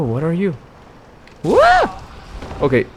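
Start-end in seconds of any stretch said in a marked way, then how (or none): 1.4-2.0 sharp high shocked whoa; startled yelp. Real, not acted. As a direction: caught off guard, yelp it out. Sudden hit from a flying enemy.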